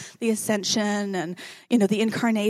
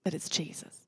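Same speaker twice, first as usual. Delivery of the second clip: a soft, awed whisper; whispered reveal; 4 semitones lower, 12 dB quieter than usual. A narrator's reading. Preacher revealing the gardener is Jesus, in a hushed whisper. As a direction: let the reveal fall into a whisper.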